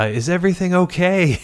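high, loud call into the distance; retold yell. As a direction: call out to someone far away. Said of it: "I yelled out".

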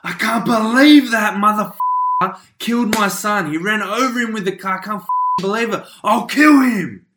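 loud, high outburst of rage; acted anger. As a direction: rage fast, then stretch out a shouted threat. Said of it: "anger of like".

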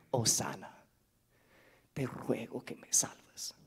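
breathless whisper of a desperate prayer; whispered plea. Acted. Preacher voicing a sinner begging for salvation, whispered. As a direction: whisper it like a secret prayer for rescue.

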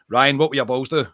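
usual speaking voice

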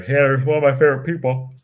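talking through a yawn; stretched yawn. Real, not acted. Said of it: "Sorry for the yawn".

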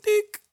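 high beeping scanner sound. Retold or imitated. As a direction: beep like a till scanner. Imitating scanner beep.

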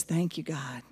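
soft, breathless whisper of prayer; hushed plea to God. Retold or imitated. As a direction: pray under your breath, exhausted. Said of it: "he takes a minute and says".

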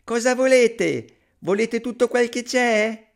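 very high, loud, drawn-out yell; piping character yell; shouting. A narrator's reading. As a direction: shout it slowly for all to hear. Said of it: "gridò di nuovo".

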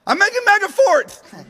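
put-on high, loud, slow squeaky boy voice; husband answers wife in a squeaky little-boy voice, high and loud; kid voice. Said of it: "I'm like".

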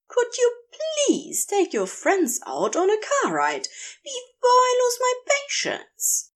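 high, prim voice of the angel, strained politeness; a proper gentleman barely holding his temper; high prissy angel begging his partner to take the demons out; fussy angel, near the end of his patience. A narrator's reading. Narrator voices Aziraphale.

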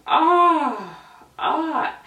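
high, drawn-out wail of embarrassment; loud dramatic cringe cry; anguished "ah". Acted. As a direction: groan aloud at the memory. Reliving the embarrassment.

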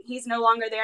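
ordinary talk

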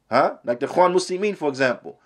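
ordinary talk

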